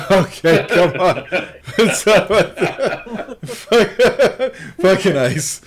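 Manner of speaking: high, loud words broken by laughter; laughing protest. Real, not acted. Answering co-host's jab, laughing helplessly. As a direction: protest while cracking up.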